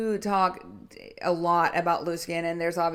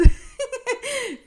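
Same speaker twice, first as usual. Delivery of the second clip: high bursting laugh; burst of laughter; 6 dB louder than usual. Real, not acted. Coach laughing at her own joke about her body. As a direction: laugh out loud at herself.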